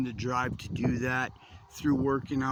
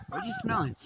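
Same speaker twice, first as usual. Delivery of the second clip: dog talk. Real, not acted. Talking to his dog.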